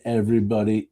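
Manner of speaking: ordinary talk, nothing marked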